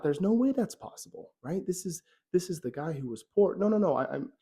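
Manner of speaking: can't believe what he hears; disbelief. Acted. Apprentice hears the poor family is now rich, stunned disbelief.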